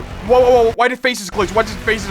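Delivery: ordinary delivery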